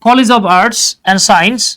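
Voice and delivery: College of Arts and Science stretched out very slowly; slow demo. Shown as a demonstration. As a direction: read the example slowly for students. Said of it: "যেমন".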